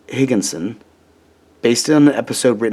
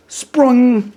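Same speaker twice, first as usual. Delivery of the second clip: jumps high on Sprung, like a spring; springy sound word; 13 semitones higher, 3 dB louder than usual. Acted. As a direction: pop the word up high and bouncy. Toy springs up in story.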